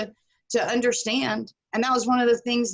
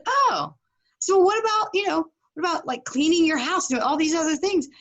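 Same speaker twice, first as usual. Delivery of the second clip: higher and rising in mock amazement; amazed questioning; 7 semitones higher than usual. Coach to student, amazed questioning. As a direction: pile on the questions in disbelief.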